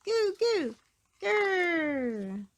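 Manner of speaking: put-on baby growl. Baby George dinosaur noise.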